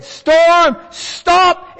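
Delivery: preacher voicing the Lord rebuking the storm, loud command; stern command. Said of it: "The Lord said".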